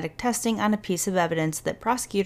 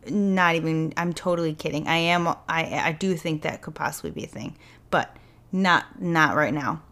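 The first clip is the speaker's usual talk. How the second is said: ordinary talk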